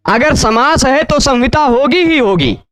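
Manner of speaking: ordinary talk, nothing marked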